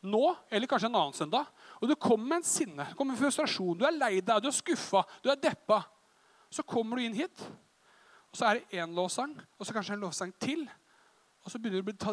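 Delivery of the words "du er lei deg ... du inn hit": ordinary delivery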